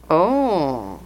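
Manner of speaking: ordinary talk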